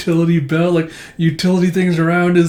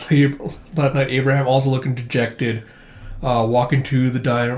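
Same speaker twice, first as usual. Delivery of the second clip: ordinary talk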